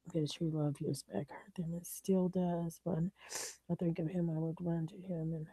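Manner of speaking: very soft, voice dropping to a whisper; emotional near-whisper. Real, not acted. Vlogger remembering lost love, emotional and hushed. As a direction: barely get the words out, hushed.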